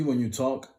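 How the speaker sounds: ordinary delivery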